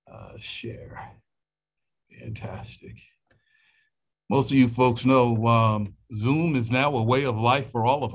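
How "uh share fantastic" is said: muttered under breath to self; barely voiced self-talk; whispered aside. Real, not acted. Muttering while sharing screen.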